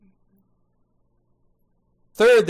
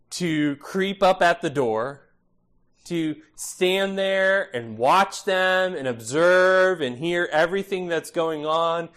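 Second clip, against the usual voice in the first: usual speaking voice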